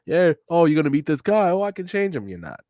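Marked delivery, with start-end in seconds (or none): none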